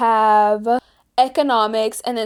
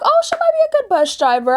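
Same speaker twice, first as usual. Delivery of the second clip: much higher, quick hopeful voice; hopeful high quote; 12 semitones higher, faster than usual. Retold or imitated. Student recalling hopes for the new bus driver, high and quick. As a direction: chirp it with naive optimism. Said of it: "And I was like".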